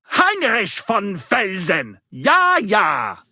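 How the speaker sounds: loud, slow mock-German proclamation of the name. Bard giving Henry a German noble name, loud mock German. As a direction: ham up a German nobleman accent.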